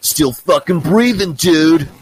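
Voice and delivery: put-on high, slow, loud gravel-free bark of an old man; loud, slow old dude boast; gruff old dude. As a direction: play the cool old guy shouting his line. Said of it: "And he goes".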